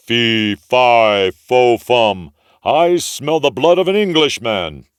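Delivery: loud booming giant chant; ogre's cry. A narrator's reading. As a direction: thunder it out like a hungry giant. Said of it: "cried the ogre".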